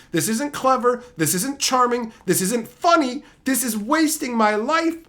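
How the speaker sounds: loud, high, exasperated tirade; furious rant. Real, not acted. Podcaster ranting about a movie, loud and furious. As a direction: let it all out, disgusted.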